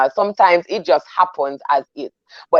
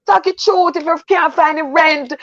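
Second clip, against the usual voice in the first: impassioned shout; 6 semitones higher than usual. Real, not acted. To the listeners, urging honest church talk: high impassioned shout. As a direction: belt it out like a preacher pleading for honesty. Urging people to tell truth.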